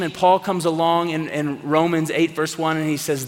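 normal talking voice

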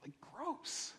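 high, hushed, disgusted disbelief; shocked Peter. Retold or imitated. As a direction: recoil at the thought of it. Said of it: "He's like".

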